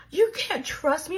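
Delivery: mock gasp, then high, drawn-out disbelief; shocked. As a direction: gasp and stretch it out like a stunned friend. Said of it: "she's so shocked".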